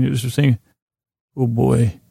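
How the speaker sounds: ordinary talk, nothing marked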